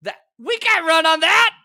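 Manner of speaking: high and loud disbelief; incredulous shout. Real, not acted. Host shouting at Republicans' strategy, incredulous. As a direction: demand to know what they're thinking.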